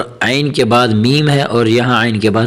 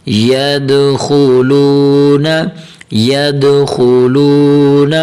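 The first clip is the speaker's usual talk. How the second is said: slow, drawn-out syllable chant; teacher reciting slowly for learners to follow; slow Quranic spelling recitation; 3 semitones higher, much slower than usual. Shown as a demonstration. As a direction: stretch each long vowel evenly as you spell it out.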